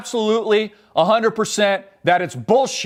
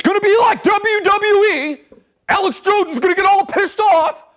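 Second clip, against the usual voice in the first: high, strained mock rant in a put-on voice; pitched-up sneering parody of a ranting host; mocking Alex Jones; 11 semitones higher, 3 dB louder than usual. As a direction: lampoon a hysterical wrestling-hype pundit.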